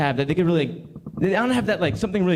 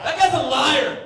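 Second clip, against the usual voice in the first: higher and louder verdict; mock accusation; 8 semitones higher, 6 dB louder than usual. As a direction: call him out. Punchline accusation.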